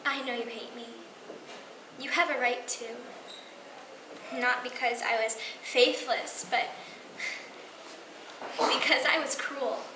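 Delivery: soft, halting admission with long pauses; subdued and contrite; remorseful confession. Acted. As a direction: confess quietly, weighing each phrase. Confession monologue to estranged husband.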